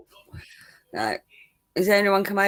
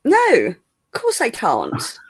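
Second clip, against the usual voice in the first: hammed-up posh English; 3 semitones higher than usual. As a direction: protest in exaggerated upper-class tones. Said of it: "Can you be posh without taking a piss".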